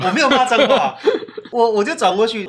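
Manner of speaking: usual speaking voice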